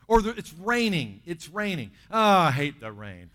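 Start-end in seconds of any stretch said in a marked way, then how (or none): 2.1-3.3 loud, drawn-out hammed-up grumble; mock complaining. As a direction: groan it out slowly and loudly, playing fed up. Acted grumbling about rain.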